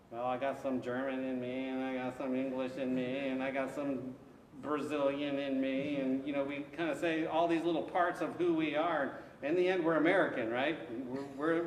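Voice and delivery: usual speaking voice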